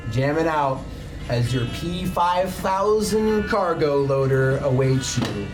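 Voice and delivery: ordinary talk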